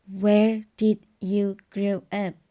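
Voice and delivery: "Where did you grow up?" said slowly, stress on "did"; slow teaching read-out; slow model question. Shown as a demonstration. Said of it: "W H question, auxiliary did".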